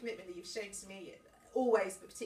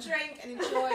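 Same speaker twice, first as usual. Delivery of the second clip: laughing; 7 semitones higher, 10 dB louder than usual. Real, not acted. Toast, laughing.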